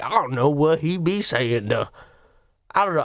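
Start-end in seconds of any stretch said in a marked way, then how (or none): 0.0-1.9 Whoopi Goldberg. Acted.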